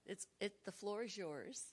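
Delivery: normal talking voice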